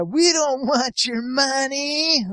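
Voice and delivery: drawn-out creepy sneer; creepy thug voice. Retold or imitated. Quoting creepy thugs.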